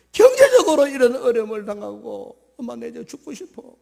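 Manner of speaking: a married daughter begging her mother for help; voicing a troubled daughter calling home; distressed daughter. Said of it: "친정 엄마한테 전화했어".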